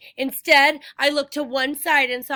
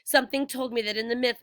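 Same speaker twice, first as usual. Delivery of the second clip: usual speaking voice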